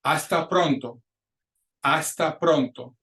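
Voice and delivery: hasta pronto deliberate, in clear Spanish; model pronunciation. Shown as a demonstration. Teacher pronouncing words for students to repeat, hasta pronto as a model. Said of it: "gonna pronounce those words for you".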